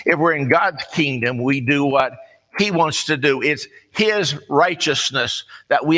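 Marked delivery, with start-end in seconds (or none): none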